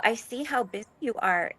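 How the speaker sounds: usual speaking voice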